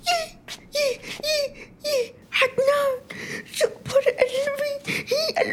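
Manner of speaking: high, crying with happiness; mother sobbing happily at her son's return; tearful joy. Acted. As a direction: weep with joy at seeing her son. Mother greets returning son.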